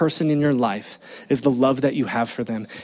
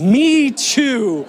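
much higher, louder, laughing delight; 9 semitones higher, 5 dB louder than usual. Retold or imitated. Father answering his son's sweet remark, laughing. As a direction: answer through a happy laugh.

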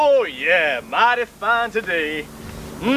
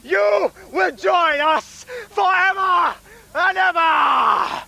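haunted house actor; 6 semitones higher than usual. Acted. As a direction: bellow the summons like a ghoul. Cultist chants, shouting.

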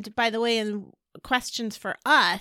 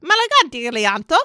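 louder, theatrical made-up Italian; fake Italian; 4 semitones higher, 8 dB louder than usual. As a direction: spout fake Italian gibberish like mom. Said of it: "I had a fake Italian ... language".

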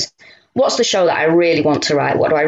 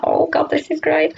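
much higher, breathy fangirl squeal; giddy delight; 10 semitones higher than usual. Retold or imitated. Fan meeting Noel, a giddy high gush.

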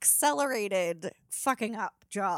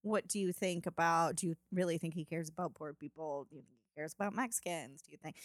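calm level-headed; 4 semitones lower, 7 dB quieter than usual. Retold or imitated. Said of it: "and I'm like".

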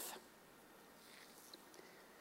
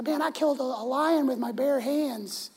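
lightly voiced eager boy, higher; boasting young David; 10 semitones higher than usual. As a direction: brag to the family like a kid.

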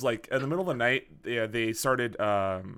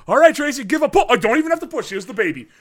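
louder, higher voice of a hurried nurse; busy nurse; 8 semitones higher, 8 dB louder, faster than usual. Retold or imitated. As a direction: play the nurse rushing the delivery. Nurse voiced in birth story.

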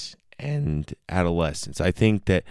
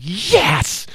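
a sharp, much higher, louder yes; mock triumphant yes; 13 semitones higher, 6 dB louder than usual. Imitating the couple gloating with a high, loud yes. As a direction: squeal the yes like you caught them.

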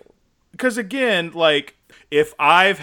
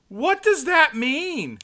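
high, slow, baffled question; 12 semitones higher, much slower than usual. Real, not acted. Friend baffled by a weird sitcom line, high and slow. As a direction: draw it out in disbelief.

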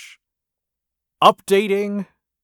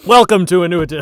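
loud game show host; 4 semitones higher, 10 dB louder, much faster than usual. Pretend. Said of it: "I'm just kidding".

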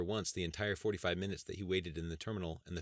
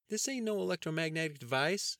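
goofy cousin Andy; 11 semitones higher, 4 dB louder than usual. Acted. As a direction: play the joking country cousin up high. Andy's character voice.

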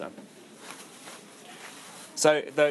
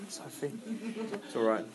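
ordinary delivery

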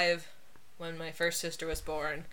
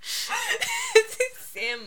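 laughing. Real, not acted. Joking full name for Sam.

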